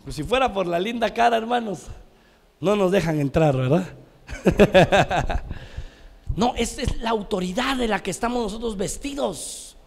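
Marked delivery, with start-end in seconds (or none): 4.3-6.1 loud chuckle at own joke; hearty laugh. Real, not acted. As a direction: laugh out loud after the punchline.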